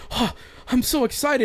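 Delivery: adoring fan voice. Retold or imitated. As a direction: squeal like the overeager fan. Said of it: "And he's like".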